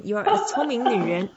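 ordinary delivery

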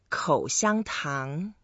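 口香糖 slowly, one syllable at a time; Chinese pronunciation demo. Shown as a demonstration. Teacher showing guest how to say chewing gum, slow pronunciation demo. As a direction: model 口香糖 slowly with each tone distinct. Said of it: "The way to say chewing gum in Chinese".

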